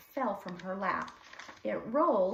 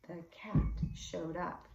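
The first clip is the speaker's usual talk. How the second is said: whisper; 2 semitones lower than usual. A narrator's reading. Suspense as cat appears.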